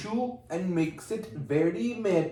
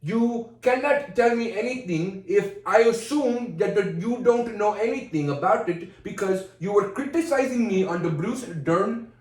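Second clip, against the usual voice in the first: emphatic put-on Indian accent; 3 semitones higher than usual. Criticized over Bruce Dern, arguing back Indian-accented. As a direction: argue the point in an Indian accent. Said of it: "I know how good my Indian is".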